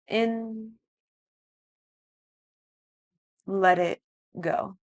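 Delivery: soft, slow meditation voice; guided meditation. Acted. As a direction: guide them gently into stillness. Leads breathwork and relaxation.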